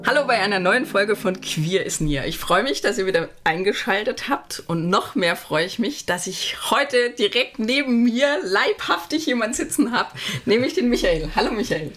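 usual speaking voice

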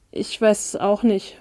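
ordinary delivery

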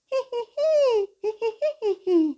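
very high tiny giggle; high squeaky giggle. Acted. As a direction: squeak out a little laugh. Ball character laughs.

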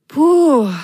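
loud high puff of relief; relieved sigh. A narrator's reading. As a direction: blow out the tension. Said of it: "seufzte Ron erleichtert auf".

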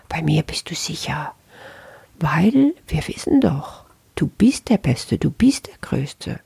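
hushed, coaxing whisper; seductive ego whisper. Acted. The ego luring the listener with flattery, in a whisper. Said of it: "Und das Ego sagt".

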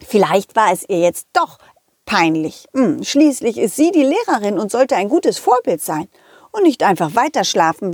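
usual speaking voice

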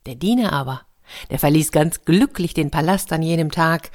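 usual speaking voice

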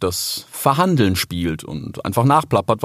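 ordinary delivery, nothing marked

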